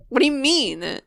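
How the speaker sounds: ordinary talk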